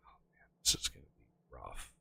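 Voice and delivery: breathy whisper under his breath; muttering to himself, barely voiced. Real, not acted. Muttering to himself while building.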